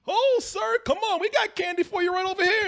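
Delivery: high, overly polite mascot voice; sugary, high theme-park helper; mock sweet bear mascot. Park bear coaxing him away from kids, high and sugary. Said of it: "the bear came up and he's like".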